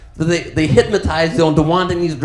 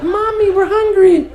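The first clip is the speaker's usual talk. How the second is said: put-on whining kids; 4 dB louder than usual. Kids begging their mother for food, high mock whine. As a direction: play hungry children calling for their mother. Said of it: "Her kids are gonna be like".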